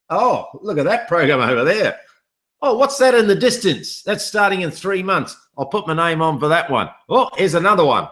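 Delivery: high, excited mock viewer voice, pitch climbing; giddy imitation of someone chasing every new offer; distracted viewer. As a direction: play a dazzled viewer spotting shiny new programs. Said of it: "because you're saying".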